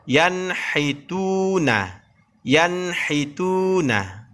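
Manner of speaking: yanhituna very slow, n crisp before h; clear n. Shown as a demonstration. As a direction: draw out yanhituna with a clean n.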